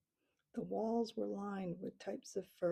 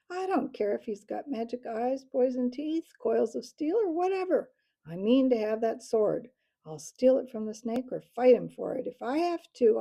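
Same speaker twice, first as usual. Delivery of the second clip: loud furious defiance, building higher; angry shout; 5 semitones higher, 10 dB louder than usual. A narrator's reading. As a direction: shout down the arrogant bird. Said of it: "He shouted angrily".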